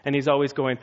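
ordinary talk, nothing marked

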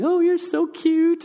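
put-on high, slow cooing over the kids; mock baby-talk gush; gushing adult. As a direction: coo it like a doting grown-up filming. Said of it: "people videotaping".

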